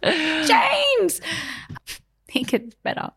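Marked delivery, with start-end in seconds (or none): 0.0-1.2 high, drawn-out exasperated name-call; hammed-up high reproach. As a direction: wail the name in mock despair. Retold exasperated cry to partner.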